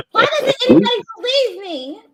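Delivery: high, loud whiny puppy plea; puppy dog voice. Pretend. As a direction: pout it like a wounded puppy. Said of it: "Look, you got puppy dog voice".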